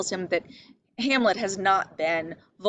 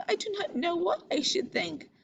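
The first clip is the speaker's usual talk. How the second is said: high, soft and timid; meek, helpless reading of Ophelia; weak and unsure; 10 semitones higher, 4 dB quieter than usual. Shown as a demonstration. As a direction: shrink from the words as if unable to decide. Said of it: "a line of weakness".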